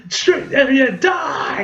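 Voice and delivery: imitated angry outburst; mock furious command. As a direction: build to a shouted, hammed-up death threat. Acting out a hostile command.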